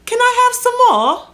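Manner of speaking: very high, loud little critter voice; begging rodent. Acted. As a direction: squeak it out like a begging pet mouse. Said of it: "as if to say".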